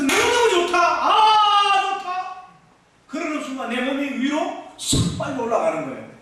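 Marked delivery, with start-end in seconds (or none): 0.0-2.3 high, gasping with delight; ecstatic exclamation. Retold or imitated. Preacher recalling the flower field, overjoyed and breathless. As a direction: let the joy burst out. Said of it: "과음을 치면서".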